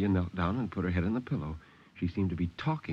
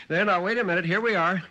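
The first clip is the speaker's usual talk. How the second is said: put-on high, loud call to a child; 11 semitones higher, 6 dB louder, faster than usual. A narrator's reading. Parents calling to a child with a nightmare, calling out. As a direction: call ahead, raised and hurried to help. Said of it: "hunting for the matches and calling".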